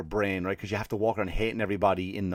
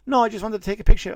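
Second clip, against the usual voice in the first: high, louder dismissive fan; mock fan voice; 12 semitones higher, 9 dB louder than usual. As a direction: squeak out the fan's refusal. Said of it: "they'll go".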